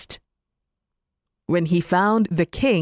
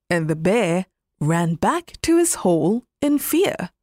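usual speaking voice

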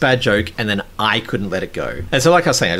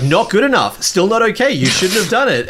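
high-pitched, imitated telling-off; mock scolding; 10 semitones higher than usual. Host mimicking himself rejecting partner's explanation, mock scolding. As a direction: replay yourself refusing to accept it, pitched up. Acting out his own unyielding argument.

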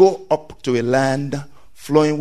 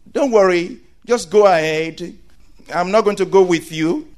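mock parent reassurance; 6 semitones higher than usual. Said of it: "your parents say".

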